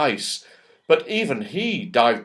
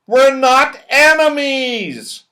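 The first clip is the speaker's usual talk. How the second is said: loud, high, slow protest; yelled; 11 semitones higher, 8 dB louder, slower than usual. A narrator's reading. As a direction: shout it across the snow. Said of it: "yelled Moonface".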